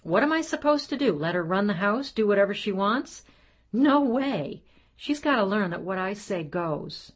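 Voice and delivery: high, loud exasperated protest; father insisting he must win, high and loud; indignant outburst. Acted. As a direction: defend yourself, fired up. Father defending himself indignantly.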